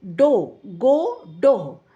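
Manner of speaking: "डोह" said with a long o, rhyming with "गो"; pronunciation demo. Shown as a demonstration. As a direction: stretch the o in "डोह" like "गो". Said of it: "इसको हम डोह पढ़ते हैं". ("डोह" को "गो" की तरह लंबा ओ देकर बोलें)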